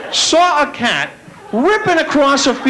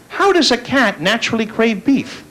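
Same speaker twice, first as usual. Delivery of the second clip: ordinary delivery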